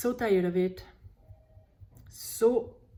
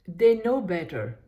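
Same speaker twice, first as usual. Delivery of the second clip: exasperated, louder outburst; fed-up rant, building to indignation; frustrated venting; 3 semitones higher, 4 dB louder than usual. Acted. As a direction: let the annoyance spill out at them. Tapping script venting frustration.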